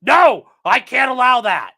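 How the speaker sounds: mock stern judge, loud; imitating a shouting judge; judge refusing. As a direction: bark the refusal like an angry judge. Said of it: "and the judge goes".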